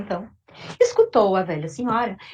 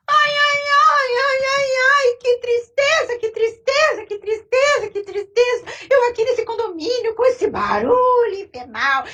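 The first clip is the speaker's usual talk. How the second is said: put-on much higher, loud whining old woman; wailing old lady; 11 semitones higher, 4 dB louder than usual. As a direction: wail like a self-pitying old lady. Old lady laments again.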